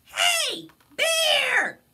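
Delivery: very high, loud calling friends; high squeaky critter voices calling out. Acted. Friends call up to Bear's window, high and loud.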